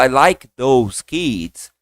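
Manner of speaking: put-on I like those kids, very slowly; slow model phrase. Shown as a demonstration. Teacher slowly models a phrase for students. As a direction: stretch every word. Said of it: "Quando eu falo".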